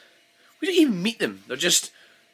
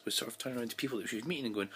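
normal talking voice

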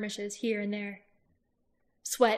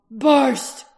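loud, higher call of a name across a battlefield; shouted challenge; 4 semitones higher, 13 dB louder than usual. Acted. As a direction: bellow the enemy's name. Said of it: "Roran repeated his call".

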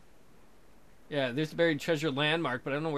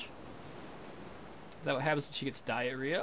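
normal talking voice

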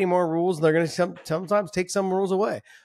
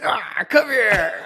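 mock fighter growl; 6 semitones higher, 4 dB louder, much slower than usual. Imitating Mark grabbing someone.

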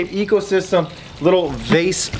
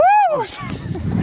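high, gleeful laugh; excited laugh. Real, not acted. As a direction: laugh out in delight. Celebrating with his brother.

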